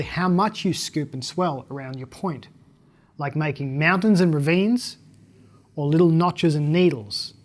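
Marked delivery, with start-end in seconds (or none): none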